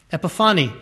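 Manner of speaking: usual speaking voice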